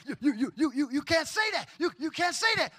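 a mock flustered wife. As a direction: sputter in excited disbelief.

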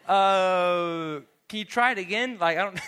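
mock-polite fluster; flustered traveller. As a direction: play the confused traveller asking nicely. Retelling confused talk with airline agent.

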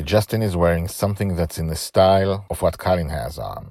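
ordinary delivery, nothing marked

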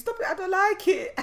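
put-on very high, loud little-girl squeal; Sana. Mother imitating daughter refusing to sit, squealed protest. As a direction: mimic a small child's indignant outburst. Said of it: "she's like".

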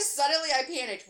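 ordinary delivery, nothing marked

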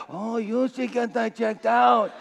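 slow, drawn-out put-on voice; concerned patient. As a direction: imitate a patient dragging out well-meant advice. Hospital patient voiced in retelling.

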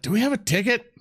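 rising, high-pitched asking; unsure question. Acted. Draconic speaker asking friends about tickets, high and unsure. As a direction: turn to the group, worried. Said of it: "I look back to Shinsen".

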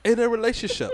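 imitation African accent, lifted high. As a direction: raise the question in a mock African royal accent.

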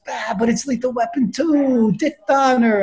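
high, imploring imitation; pleading protest. Buddy begging friend not to quit the audition, pleading.

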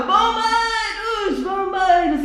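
high drawn-out call. A narrator's reading. Mother calling the firemen, high and drawn-out. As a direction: shout for the firemen, stretched. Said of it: "E a mãe gritava".

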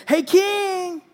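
high, drawn-out hail; shouting out. Acted. David hails the king from the cave, shouting. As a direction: call to someone far off. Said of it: "and he shouts out".

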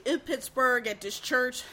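talking through a yawn; stretched, yawning words. Real, not acted. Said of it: "I'm sorry for yawning".

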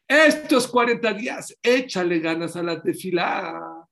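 loud, slow push on "échale ganas"; urging preacher. Shown as a demonstration. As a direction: drive "estos cuarenta días" home loud and high. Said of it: "preacher urges congregation". (exhortación fuerte y lenta)

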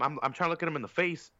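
ordinary talk, nothing marked

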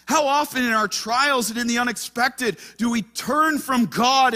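high, loud pulpit delivery; full-force preaching. Acted. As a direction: drive the point home to the congregation.

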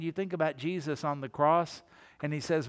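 usual speaking voice

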